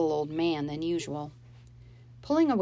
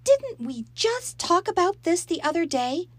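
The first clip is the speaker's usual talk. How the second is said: much higher and louder, reproachful mother; scolding mother; 13 semitones higher, 6 dB louder than usual. Acted. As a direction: scold her for leaving. Mom scolding, frowning.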